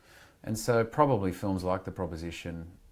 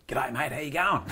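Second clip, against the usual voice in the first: cheeky broad Aussie greeting, pitched higher; Australian accent; 8 semitones higher, 4 dB louder than usual. Retold or imitated. Sneaking up on Jack Thompson with a put-on Australian accent. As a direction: play up an Aussie accent to surprise a mate. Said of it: "with that fucking accent".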